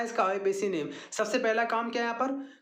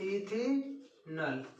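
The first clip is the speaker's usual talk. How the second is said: Ethanol stretched very slowly, syllable by syllable, softer; dictating slowly; 5 dB quieter, much slower than usual. Shown as a demonstration. Teacher dictates answer for students to copy, very slow. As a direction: drag out ethanol so they can write it.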